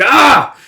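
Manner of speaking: high, loud cry of protest; acted yell. Guts being carried off to the party, yelling out.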